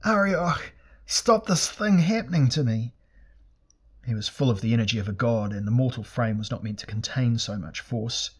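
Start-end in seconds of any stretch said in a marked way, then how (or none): 0.0-2.9 high-pitched agonised plea; screaming. A narrator's reading. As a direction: cry out desperately, pitched well above normal.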